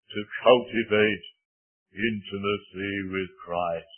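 a hint of a deep-voiced elderly evangelist; old evangelist's bass voice. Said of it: "he had a very fine bass voice".

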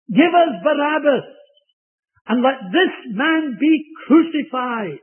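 high, loud demanding yell; crowd shouting. Acted. The crowd shouting to Pilate for Barabbas, loud and high. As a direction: cry out for Barabbas like an angry mob.

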